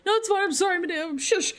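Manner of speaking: high, flustered apology. Acted. Apologising to madame, flustered.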